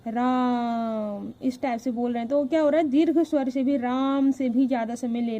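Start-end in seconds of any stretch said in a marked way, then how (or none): 0.1-1.3 राम with the रा drawn out very long, म short; stretched Raa. Shown as a demonstration. Teacher showing students the pluta vowel, रा stretched very long. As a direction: draw out the रा in राम slowly. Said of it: "इस तरीके से लंबा खींच के रा बोलेंगे".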